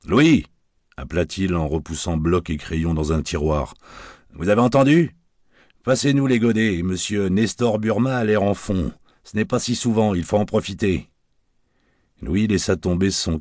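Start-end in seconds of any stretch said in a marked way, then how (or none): 0.1-0.5 high, loud call across the bar. Acted. Barman calls out to his waiter Louis, loud call. 4.3-11.1 lightly voiced barman, higher and jaunty; a hint of a wisecracking Paris barman; cheeky barman. Barman orders drinks for his old friend, jaunty higher voice.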